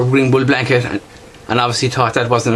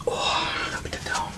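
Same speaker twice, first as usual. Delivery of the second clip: whisper; 12 dB quieter, slower than usual. Real, not acted. Marvels at knife close up.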